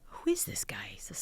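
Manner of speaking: high, astonished whisper. Real, not acted. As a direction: whisper it in disbelief. Amazed at Santa returning.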